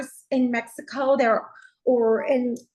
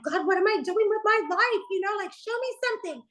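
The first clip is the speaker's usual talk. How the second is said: a retold tearful cry, pitched up and hushed; crying prayer; 8 semitones higher, faster than usual. Lying face down, crying to God for direction. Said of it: "just crying".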